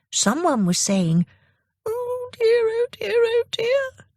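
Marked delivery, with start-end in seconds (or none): 1.9-4.1 put-on frightened old lady. Old lady on the train who lost her purse, frightened and high. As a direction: let each oh dear wobble with worry. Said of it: "in a frightened voice".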